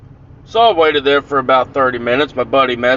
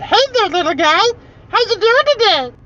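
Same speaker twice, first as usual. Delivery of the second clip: squeaky, very high puppet-like voice; creepy falsetto greeting. Retold or imitated. Prankster greets boy through cracked closet door, creepy high voice. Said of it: "and I said".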